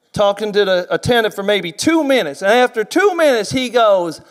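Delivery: ordinary talk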